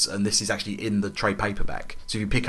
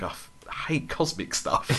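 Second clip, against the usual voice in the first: breathy laughing quote; laughing through a quote; 3 semitones higher, 9 dB quieter than usual. Retold or imitated. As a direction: giggle the line under your breath. Retelling Jack Flag's complaint.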